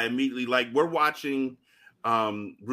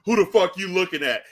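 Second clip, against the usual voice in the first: loud, higher-pitched mock tough guy; put-on tough voice; 8 semitones higher, 7 dB louder, faster than usual. Shown as a demonstration. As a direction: play a confrontational character, louder than usual. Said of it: "you can make your voice deep on purpose".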